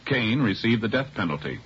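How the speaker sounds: ordinary delivery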